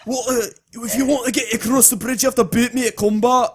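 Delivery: broad cartoon Scots; Scottish accent. Co-host quoting Samurai Jack, broad Scottish challenge. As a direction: quote it like a Scottish warrior. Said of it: "It's from Samurai Jack".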